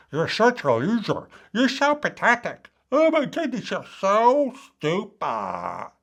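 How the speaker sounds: squeaky high silly voice, stretched out slowly; mock the critic in a cartoonish squeal; ridiculous voice. Shown as a demonstration. Teacher mocking the inner critic with a ridiculous high voice. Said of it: "make it ridiculous".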